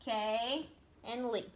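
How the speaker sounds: slow "Kay", pause, "and Lee"; split into syllables. Shown as a demonstration. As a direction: set "Kay" and "Lee" apart as two parts. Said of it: "She has".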